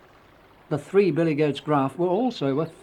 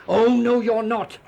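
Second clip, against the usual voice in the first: loud, high bellow; big goat shouting the troll down; defiant bellow; 8 semitones higher, 5 dB louder than usual. A narrator's reading. Third goat defies the troll, bellowing. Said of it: "bellowed the third billy goat Gruff".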